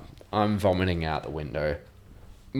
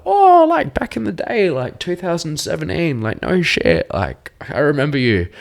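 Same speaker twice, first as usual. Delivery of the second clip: very high, louder squealing recognition; excited squeal; 11 semitones higher, 7 dB louder than usual. Retold or imitated. Old friends recognising each other at festival, excited squeal. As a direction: squeal it, over the moon. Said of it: "we're like".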